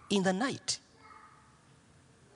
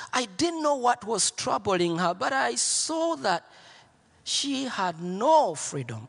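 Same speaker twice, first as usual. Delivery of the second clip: ordinary delivery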